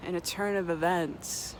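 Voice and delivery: ordinary talk, nothing marked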